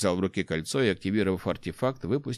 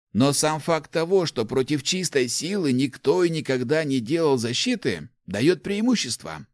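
put-on higher-pitched, animated old man's voice; enthusiastic old inventor; 7 semitones higher, 3 dB louder than usual. Old inventor explains artefacts to the rector, enthusiastic. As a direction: explain your discovery eagerly, pitched up.

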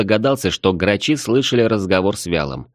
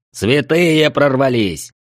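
shouting; 6 semitones higher, 3 dB louder, slower than usual. A narrator's reading. Alarm shouted in battle.

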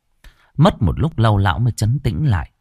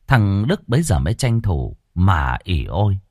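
ordinary delivery

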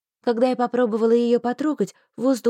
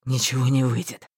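much lower, resigned; defeated male voice; 10 semitones lower than usual. A narrator's reading. Marston to himself, defeated and low. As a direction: give up.

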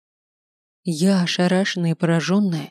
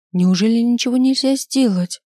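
high, louder worried plea; anxious pleading; 5 semitones higher, 4 dB louder than usual. A narrator's reading. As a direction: beg anxiously for a way out. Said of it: "подала голос мама".

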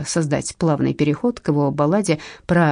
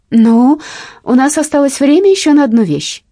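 loud; 8 semitones higher, 7 dB louder than usual. Acted. Addresses audience on stage.

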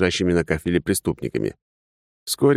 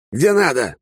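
irritated; 9 semitones higher, 4 dB louder than usual. Acted. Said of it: "раздражённый ответ".

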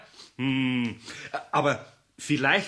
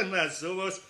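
laughing and shaking his head; amused laughter; 3 semitones higher than usual. Real, not acted. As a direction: chuckle in disbelief. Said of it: "musste laut lachen".

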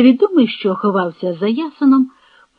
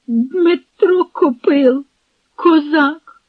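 high, slow, halting answer; frightened; 6 semitones higher, much slower than usual. A narrator's reading. Trembling captive gives his name to Semen, frightened. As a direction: answer timidly in a raised voice.

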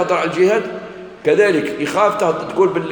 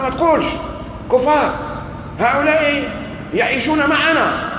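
raised, pitched-up imitated yelling; mock shout; 13 semitones higher than usual. Minister shouting at the sheikh on the phone, mock yell. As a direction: play the minister scolding down the phone. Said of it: "وبدأ يصيح على ذلك الشيخ".

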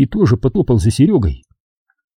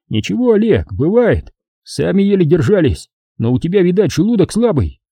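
raised, consoling army buddy; soldier comrade voice; 7 semitones higher than usual. Acted. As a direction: cheer up a sick comrade.

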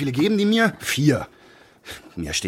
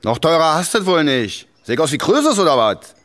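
mock quarrel; 8 semitones higher, 7 dB louder than usual. Inspector fakes a row with decoy for show, loud mock complaint. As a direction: play the stingy punter, loudly. Staged quarrel as stingy customer.

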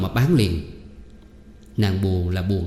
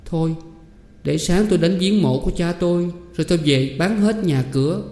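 much higher, wavering plea; near tears; 8 semitones higher than usual. A narrator's reading. Girl tells aunt she has nothing left, near tears. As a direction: answer on the verge of crying. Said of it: "Ánh Nguyệt già khóc và đáp rằng".

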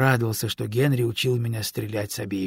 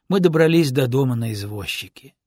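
ordinary delivery

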